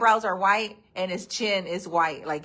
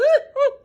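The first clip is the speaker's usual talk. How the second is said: sudden squealing laugh, much higher; high burst of laughter; 5 dB louder than usual. Real, not acted. Laughing at herself for falling in the dog's hole. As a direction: laugh out at your own fall.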